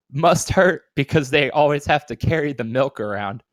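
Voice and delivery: normal talking voice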